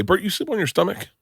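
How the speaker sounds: high, teasing question; lightly voiced Gary poking fun; imitating a friend. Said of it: "He would always say".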